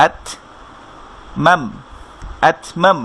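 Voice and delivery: usual speaking voice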